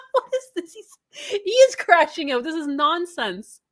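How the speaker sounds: high-pitched, laughing disbelief; cracking up. Real, not acted. Reader reacting to a streamer crashing out, laughing incredulously. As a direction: break into laughter mid-sentence. Cracking up over the rant he read.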